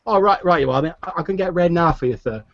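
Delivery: put-on high eager boy voice; young stable boy. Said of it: "He's like".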